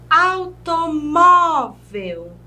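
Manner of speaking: "automóvel" slow and loud, MÓ stretched; penultimate stress. Shown as a demonstration. Teacher showing the tonic syllable, "automóvel" drawn out on MÓ. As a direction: lean hard on the mó of "automóvel". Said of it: "a penúltima sílaba como sílaba tônica".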